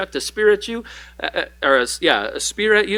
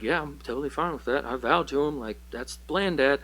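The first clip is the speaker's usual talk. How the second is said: quick and much softer, casually unbothered son; offhand, soft shrug; 7 dB quieter than usual. Retold or imitated. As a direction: toss it off like it's no big deal. Jonathan answers father casually.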